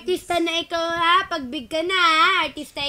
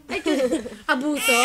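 ordinary delivery

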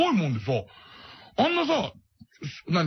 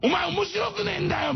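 high, sneering jab; mock insult; 9 semitones higher than usual. Beluga insulting Tashiro's comedy, high sneering jab. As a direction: sneer it as a put-down. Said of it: "みたいな感じになっちゃうんだよね".

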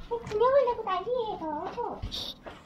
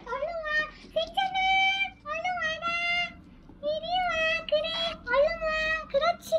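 coaxing pet voice; 6 semitones higher than usual. Real, not acted.

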